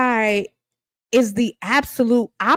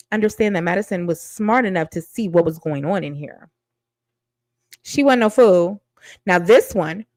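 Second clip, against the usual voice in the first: normal talking voice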